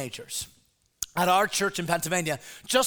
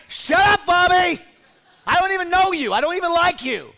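much higher and louder, fed up; mock exasperated shout; 8 semitones higher, 7 dB louder than usual. Pastor retelling yelling at the pesky boy, hammed-up shout. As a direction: yell back like you're sick of him.

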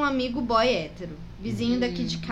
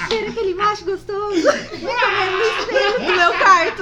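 high, loud, laughing through the words; can't stop laughing reading it; laughing while reading; 7 semitones higher, 7 dB louder than usual. Real, not acted. Mock erotic story reading.

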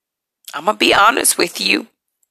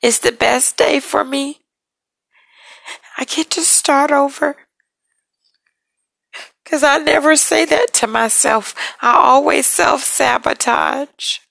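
holding back tears while talking; real emotion, pitch climbing with strain; emotional; 5 semitones higher than usual. Real, not acted. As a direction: voice lifting, close to tears, rising high. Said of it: "forgive me for getting a little emotional".

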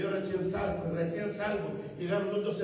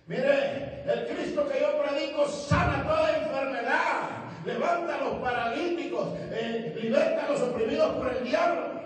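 higher, louder proclamation; full-force preaching; 8 semitones higher, 6 dB louder than usual. Acted. Preacher to the crowd in the plaza, full-force preaching.

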